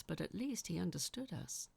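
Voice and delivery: plain reading voice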